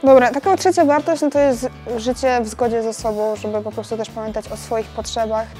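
ordinary talk

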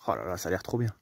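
ordinary talk

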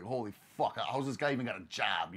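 quiet, low disbelieving mutter; muttered aside. Retold or imitated. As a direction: mutter it to yourself, low and soft. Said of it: "I was just like".

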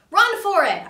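loud, high shout; Larry shouts a warning to Percy. Acted. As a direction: yell it out in alarm. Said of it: "I shouted to Percy".

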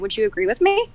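ordinary talk, nothing marked

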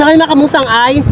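ordinary talk

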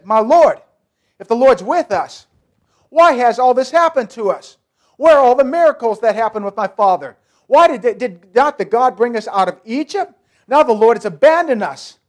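loud, aggrieved protest; desperate, indignant cry of why; loud complaint to God. Acted. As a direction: throw the accusations at God. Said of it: "he begins to complain against God".

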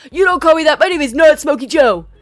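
imitated angry shout, high and very loud; upset yelling. Homeless man shaking a knife over his name, angry imitation. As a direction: shout each word in mock outrage. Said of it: "getting upset with me".

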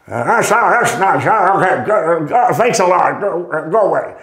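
mock dismissive old pro waving him off; dismissive brush-off. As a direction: shoo the kid away, bored and curt. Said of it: "but that was the tone".

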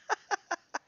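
short high giggle. Real, not acted. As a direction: giggle with delight. Laughing again.

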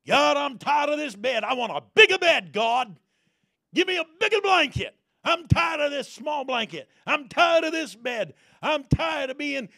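loud, high, pleading cry to God; shouted prayer. Acted. Preacher voicing bold prayer to God.

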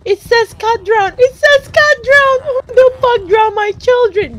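high, loud, drawn-out shouting; slow, high-pitched yell of outrage; outraged wail. Real, not acted. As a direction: wail in disbelief at a game loss. Outrage at drowned game cat.

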